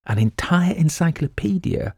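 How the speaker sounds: high, rising incredulous question; astonished. A narrator's reading. Ann hears Quaid read an encyclopedia, astonished. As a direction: repeat it in amazed disbelief. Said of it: "looked at him in astonishment".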